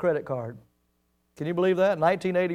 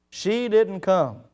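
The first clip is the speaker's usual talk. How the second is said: ordinary talk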